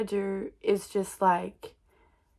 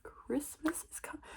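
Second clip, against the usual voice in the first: mock whisper; 3 semitones higher, 10 dB quieter than usual. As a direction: whisper it like a secret horror. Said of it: "And I was like".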